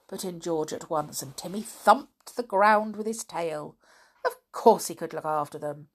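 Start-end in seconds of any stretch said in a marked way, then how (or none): none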